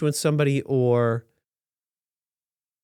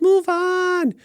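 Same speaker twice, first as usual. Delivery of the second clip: mocking sing-song, pitched much higher; dismissive mock chant of "move on"; brushing off a scandal in a high mocking voice; sarcastic. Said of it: "I'm being sarcastic here again".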